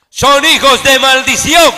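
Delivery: slow, loud scripture reading; lectura enfática. Acted. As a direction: read the verse out with weight. Reads scripture with force. (lectura bíblica lenta y fuerte)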